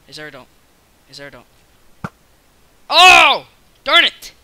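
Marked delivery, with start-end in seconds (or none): 2.9-4.3 loud, high annoyed cry; frustrated yell. Real, not acted. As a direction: shout at getting killed. Dying in game.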